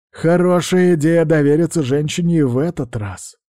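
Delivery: ordinary delivery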